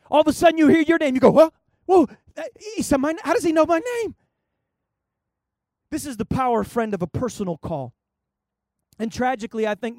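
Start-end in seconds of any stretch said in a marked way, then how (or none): 1.3-4.1 startled. Retold or imitated. Listener startled by the call.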